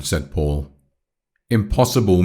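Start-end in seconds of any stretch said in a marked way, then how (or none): none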